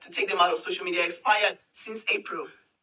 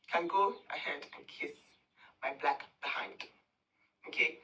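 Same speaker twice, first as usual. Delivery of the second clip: whisper; 5 semitones lower, 9 dB quieter than usual. Acted. Dismissive insult to rival's followers.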